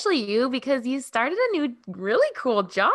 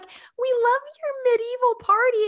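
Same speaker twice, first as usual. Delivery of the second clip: much higher, bright imitation of strangers; passersby complimenting the party, high mock voice; 4 dB louder than usual. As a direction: mimic cheerful passersby, pitched way up. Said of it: "They were like".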